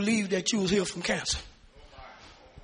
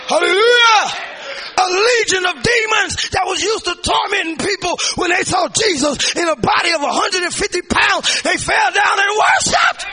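loud, high preaching with a shouted build; full-force preaching; 9 semitones higher, 9 dB louder than usual. Real, not acted. Preacher urging the church not to back down, shouting. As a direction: drive the congregation, don't let them back down.

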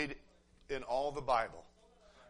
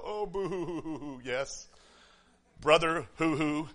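hammed-up boo-hooing on drawn-out vowels; mock weeping; slower than usual. Preacher mimicking people worked up at the altar, mock sobbing. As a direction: imitate a tearful altar convert, mockingly.

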